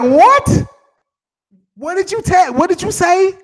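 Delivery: high, loud incredulous what; shocked disbelief. Retold or imitated. Telling a viewer he's shocked by his comment, loud and high. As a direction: act floored by what you just heard.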